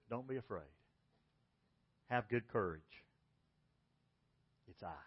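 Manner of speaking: gentle comfort. Retold or imitated. Jesus comforting disciples.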